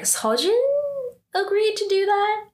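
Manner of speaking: can't believe what she's hearing; high voiced disbelief from Yoonhee; surprised. Yoonhee hears surprising news.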